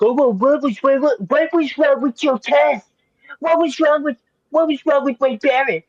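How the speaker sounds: high, whiny complaining fan; whiny internet fan. Retold or imitated. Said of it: "the internet is gonna, like, crash out and go".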